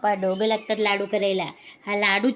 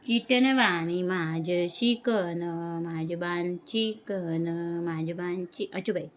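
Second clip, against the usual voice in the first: put-on slow baby talk; 3 semitones lower, slower than usual. Cooing slowly to baby.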